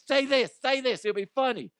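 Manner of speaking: high, pushy little demon urging; devil voice. Retold or imitated. Little demon in his head urging a bad joke, high and pushy. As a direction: egg him on like a tiny devil. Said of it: "little demons in my head".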